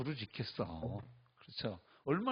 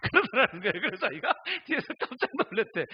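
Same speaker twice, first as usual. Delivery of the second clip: laughing; 9 semitones higher, 8 dB louder than usual. Real, not acted. Laughing while retelling.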